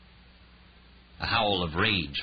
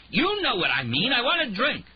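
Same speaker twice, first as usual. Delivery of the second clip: loud, much higher furious demand; raging; 4 dB louder than usual. Acted. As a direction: rage at her for a drink. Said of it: "He raged".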